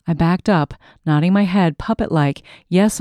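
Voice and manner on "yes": ordinary delivery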